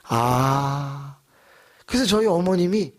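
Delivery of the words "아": ordinary talk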